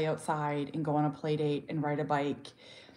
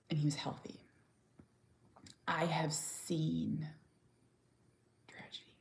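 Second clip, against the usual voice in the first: hushed, breathless whisper; 11 dB quieter than usual. Real, not acted. Mother telling of her friends' children, whispering. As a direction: drop to a bare whisper.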